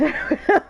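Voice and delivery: high loud laugh; burst of laughter. Real, not acted. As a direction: crack up laughing. Laughs at own joke.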